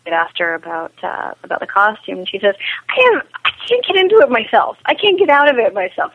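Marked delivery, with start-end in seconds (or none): 2.9-6.1 high, quick mock complaint; imitated exasperated actress. Producer retelling an actress's costume troubles, imitated exasperated complaint. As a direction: play her exasperation, pitched well up.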